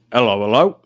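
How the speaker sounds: hammed-up copper greeting; a hint of an old-school policeman; old-time policeman. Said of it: "Like a policeman from the olden days".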